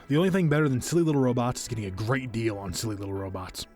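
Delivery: usual speaking voice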